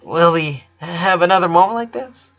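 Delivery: high, trembling tearful voice; tearful question. A narrator's reading. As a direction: ask it hoping against hope. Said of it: "cough a little and take a couple deep breaths".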